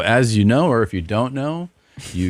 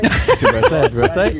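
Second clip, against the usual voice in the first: laughing; 10 semitones higher, 4 dB louder than usual. Real, not acted. Laughing echo of the prank.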